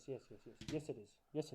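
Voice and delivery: normal talking voice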